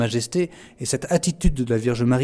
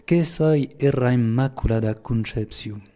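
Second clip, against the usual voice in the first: slow, deliberate Lourdes patois; measured Occitan patois quotation; slower than usual. Shown as a demonstration. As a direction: recite it slowly in the local patois of Lourdes. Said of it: "je vais le dire en patois".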